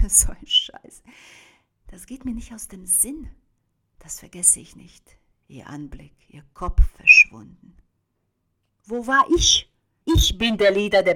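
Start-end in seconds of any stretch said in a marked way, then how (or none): 0.1-5.2 soft, haunted whisper; hushed and shaken. Acted. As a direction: barely breathe the words to yourself. Haunted memory, whispered lines.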